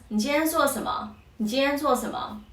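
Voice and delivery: fast, clipped casual question. Shown as a demonstration. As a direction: rattle it off quickly like a native. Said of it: "Try to say it faster".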